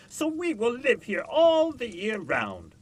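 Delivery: high, loud, slow cry of the frost; a loud, drawn-out wail of winter; wintry cry. A narrator's reading. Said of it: "they cried".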